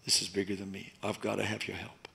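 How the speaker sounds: whisper-close desperate prayer; softly praying for help in the driveway; quiet prayer. Retold or imitated. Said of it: "and say".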